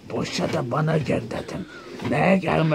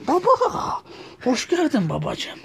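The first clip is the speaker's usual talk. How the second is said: high voice; 10 semitones higher than usual. Acted. Greeting father in put-on voice.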